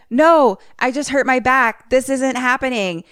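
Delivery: panicked denial. Retold or imitated. Man denies he is dead.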